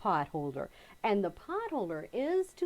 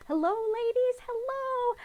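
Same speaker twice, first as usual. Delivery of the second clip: sing-song greeting; 13 semitones higher, slower than usual. Acted.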